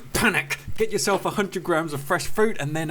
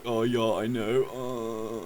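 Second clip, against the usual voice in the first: smug shrug; 5 semitones lower, 4 dB quieter, much slower than usual. Retold or imitated. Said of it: "you can be like".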